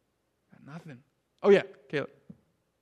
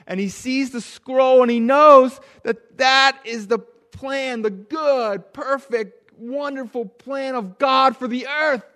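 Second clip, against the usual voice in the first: rising, louder preacher's build toward the climax; building sermon preaching; 5 semitones higher, 5 dB louder, slower than usual. Acted. Preacher telling children of John's vision, rising intensity. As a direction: swell the voice as the vision grows.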